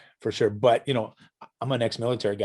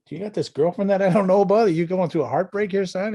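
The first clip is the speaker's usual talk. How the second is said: mock concerned teasing; 9 semitones higher, 5 dB louder than usual. Teasing son about breakup song.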